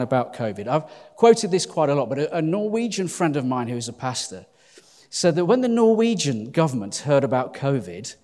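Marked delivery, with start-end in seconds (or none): none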